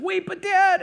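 imitating a panicky kid, high and loud; mock high-pitched plea to dad; scared child's protest. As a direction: cry out like an alarmed little girl. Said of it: "she's, like, shaking".

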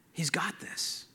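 hushed, almost whispered confidence; quiet assurance. Retold or imitated. Man at peace trusting God to take care of him, hushed assurance. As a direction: let it fall very soft, at peace.

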